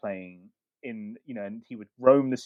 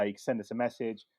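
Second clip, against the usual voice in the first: normal talking voice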